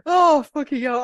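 high loud exclamation; embarrassed outburst. Real, not acted. Apologising to listeners after the joke, high loud blurt.

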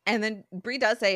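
ordinary talk

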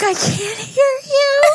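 very high, drawn-out mock cry; exaggerated high-pitched wail; straining to be heard. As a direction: squeal it slowly, imitating a strained caller. Retold call with a lost, raspy voice.

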